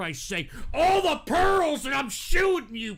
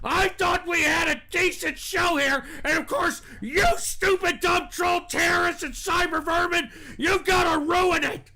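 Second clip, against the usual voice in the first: angry shouting; 3 semitones higher than usual. Real, not acted. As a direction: blast them for ruining the show. Host shouts at trolls.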